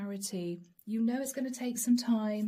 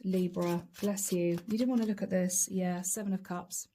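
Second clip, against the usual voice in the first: ordinary delivery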